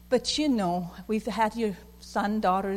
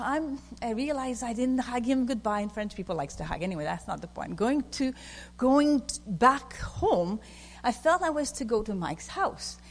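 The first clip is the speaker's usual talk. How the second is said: usual speaking voice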